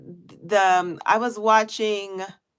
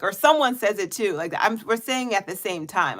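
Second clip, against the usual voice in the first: usual speaking voice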